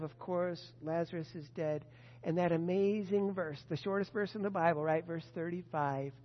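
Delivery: normal talking voice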